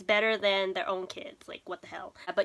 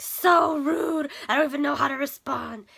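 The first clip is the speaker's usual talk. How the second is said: offended prince; 4 semitones higher than usual. Retold or imitated. Retelling the prince's offended reply.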